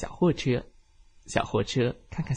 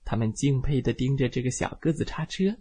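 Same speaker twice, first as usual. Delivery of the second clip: ordinary delivery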